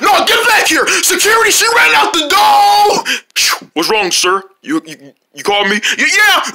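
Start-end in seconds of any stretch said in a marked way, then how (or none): 0.0-3.0 shouting. Acted. Yelling for security. 3.7-5.8 security guard. Acted.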